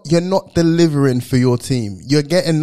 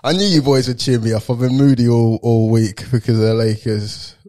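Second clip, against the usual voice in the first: usual speaking voice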